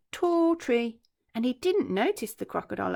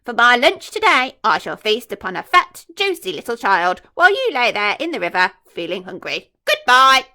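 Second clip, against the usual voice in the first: louder, gleeful villain voice; gloating crocodile; 3 semitones higher, 13 dB louder than usual. Acted. Enormous croc gloating to the small croc, loud and gleeful. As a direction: savour every word of the feast.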